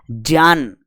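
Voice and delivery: "ज्ञान" said as "jyaan"; "ज्ञान" with j in place of g, falling; correct pronunciation. Shown as a demonstration. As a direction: say "ज्ञान" starting on j. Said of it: "ये क्या है?".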